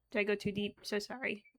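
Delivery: usual speaking voice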